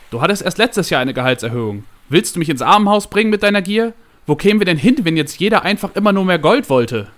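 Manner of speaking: loud, high-pitched indignant tirade; thundering. A narrator's reading. Boss berates secretary for supposed greed, thundering. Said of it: "donnerte Javier".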